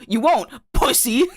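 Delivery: high, teasing jeer; sneering playground dare; mocking taunt. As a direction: goad them into pulling the trigger.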